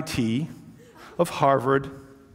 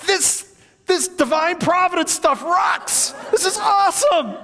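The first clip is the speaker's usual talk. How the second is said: excited yelling. Retold or imitated. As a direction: whoop it out while leaping off the board. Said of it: "jumping off the diving board yelling".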